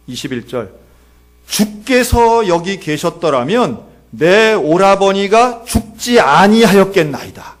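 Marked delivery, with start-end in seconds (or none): 1.5-7.6 slow, loud scripture declamation; Martha's scripture lament. Acted. Martha speaks to Jesus about her dead brother, slow declaimed lament. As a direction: draw out each phrase forcefully. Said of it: "마르다도 한마디 하는 거예요".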